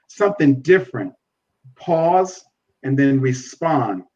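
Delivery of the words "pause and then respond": slow, each word given its own weight; a preacher slowing down to drive the point home; slow, deliberate advice. Shown as a demonstration. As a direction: pause and then respond, spelled out slowly. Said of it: "Do not go too fast".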